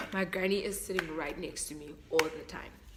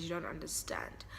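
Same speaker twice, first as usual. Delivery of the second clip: whisper; 2 semitones lower, 8 dB quieter than usual. Real, not acted. Emotional aside.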